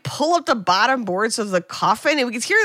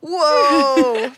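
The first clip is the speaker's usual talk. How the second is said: loud, higher amazed whoa; kids' amazed whoa; 4 semitones higher, 5 dB louder than usual. Retold or imitated. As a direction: gasp it out like kids hearing something naughty. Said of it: "And we're like".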